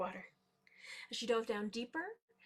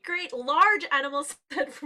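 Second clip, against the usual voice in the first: ordinary delivery, nothing marked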